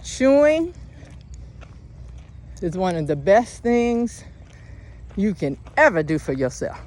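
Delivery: high, loud preacher call; preaching. Acted. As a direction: belt it out like a sermon. Preaching peak.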